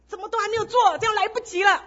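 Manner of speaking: high, loud flustered scolding; retold angry outburst. Wife scolding husband about the gathering, high and loud. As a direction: complain in a rush, upset and late.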